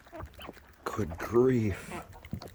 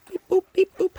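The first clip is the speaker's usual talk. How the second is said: very high sing-song beep boop, playful; 4 dB louder than usual. Pretend. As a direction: chirp it way up like a toy. Playful noises to the birds.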